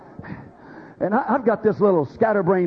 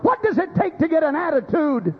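high, ringing preacher's demand; impassioned challenge; 10 semitones higher than usual. Real, not acted. Preacher challenging congregation about bad attitudes, high impassioned demand. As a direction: pitch it high and press the question.

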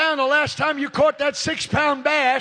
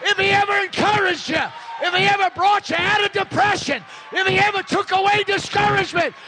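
raised, shouted preaching rebuke; fiery sermon rebuke; 7 semitones higher than usual. Real, not acted. Preacher scolding the silent congregation, fervent shouting. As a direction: drive it home to the pews.

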